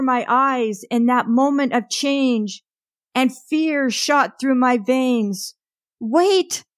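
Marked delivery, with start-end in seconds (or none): 6.0-6.6 sudden high-pitched yell, barely louder. Acted. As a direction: cry out high and sharp in sudden fear. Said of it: "I yelled so loudly".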